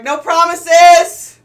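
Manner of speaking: very loud, high, drawn-out holler; Caspian yelling back. Acted. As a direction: shout it back across the battlefield. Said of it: "You hear Caspian back".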